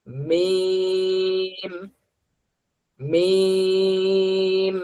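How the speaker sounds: মিম held long, lips closed at the start and at the end; drawn-out meem. Shown as a demonstration. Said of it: "সবাই বলি". (টেনে টেনে মিম)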